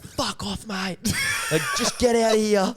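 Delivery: high-pitched imitation of telling someone off; mock angry abuse. Mate abusing poor old guy in boat, mock angry. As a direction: send him packing in a high voice.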